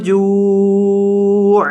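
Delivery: slow chanted recitation of the verse; Quran recitation. Shown as a demonstration. Teacher reciting for the class, slow chant. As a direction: draw every vowel out like tilawat.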